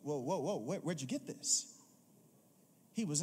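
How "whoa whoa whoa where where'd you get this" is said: lower, astonished put-on voice of the head waiter; amazed maitre d'. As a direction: gasp at the taste of fine wine.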